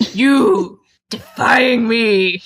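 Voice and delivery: a hint of a menacing murmur. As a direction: read him as a controlling lover. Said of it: "I will read them".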